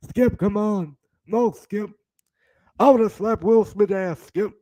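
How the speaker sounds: hammed-up indignant sports pundit; Shannon Thorpe. Pundit bragging to Skip about slapping Will Smith, imitation voice. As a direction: boast to your co-host in a put-on pundit voice. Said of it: "I'm Shannon Thorpe".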